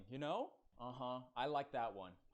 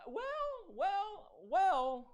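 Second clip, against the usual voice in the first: dramatic well well well; 6 dB louder, much slower than usual. Acted. Dramatic reaction to name.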